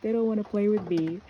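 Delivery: put-on high, loud little-kid complaint; mock crying child's protest; imitated tearful child. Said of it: "why are you crying".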